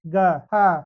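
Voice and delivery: loud answer called back after the question; quiz answer. Shown as a demonstration. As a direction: answer your own quiz like a teacher. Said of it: "जैसे ये क्या है". (सवाल के बाद ज़ोर से जवाब)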